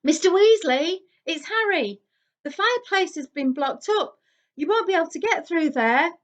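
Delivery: high, loud explaining through a wall; Harry calling through the boarded fireplace. A narrator's reading. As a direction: speak up so he hears.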